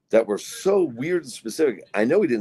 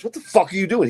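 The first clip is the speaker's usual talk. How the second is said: higher, louder mock outburst; indignant yell; 9 semitones higher, 4 dB louder than usual. Four-year-old son shouting at the man sniffing him, mock yell. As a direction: play the kid snapping at the stranger.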